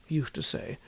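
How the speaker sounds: ordinary delivery, nothing marked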